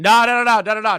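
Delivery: loud emphatic string of no; loud repeated no. Real, not acted. Host shouting down the coach's point, loud repeated no. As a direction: cut the idea off loudly, no after no. Host loudly rejecting a claim.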